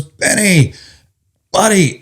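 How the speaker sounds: mock hearty greeting. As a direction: imitate a pal hailing an old friend. Friend from academy greets him.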